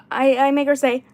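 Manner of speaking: usual speaking voice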